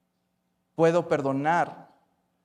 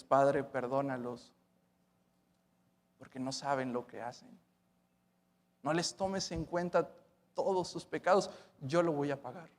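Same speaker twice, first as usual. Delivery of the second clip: tender quiet plea; 2 semitones lower, 7 dB quieter than usual. Acted. Preacher voicing Christ on the cross, quietly and tenderly. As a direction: speak it like a dying prayer.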